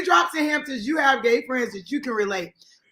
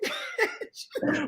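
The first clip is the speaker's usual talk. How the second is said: disgusted; 8 semitones higher, 10 dB quieter than usual. Pretend. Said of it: "So I'm gonna be like".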